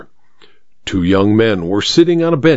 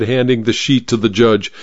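usual speaking voice

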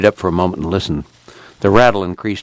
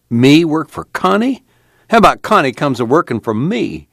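higher-pitched, indignant scoff; scornful snort; 8 semitones higher than usual. A narrator's reading. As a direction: snort it back, offended at the very idea. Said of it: "Al snorted".

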